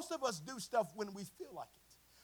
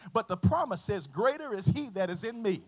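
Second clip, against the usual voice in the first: loud, building sermon climax; full-force preaching; 12 dB louder than usual. Acted. Preacher declaring victory to the church, full-force preaching. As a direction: proclaim it loudly, drawing out the big phrases.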